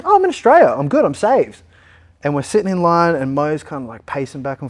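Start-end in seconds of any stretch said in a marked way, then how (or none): 0.0-1.6 high, bright-spirited relief; cheery relief. Retold or imitated. Patient sitting in the sun, feeling saved, chirpy and much higher. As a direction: chirp it out, glad to be safe. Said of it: "I'm in pretty good spirits".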